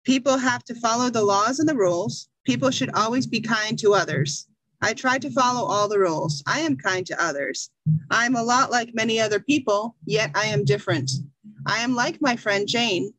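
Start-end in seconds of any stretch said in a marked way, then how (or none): none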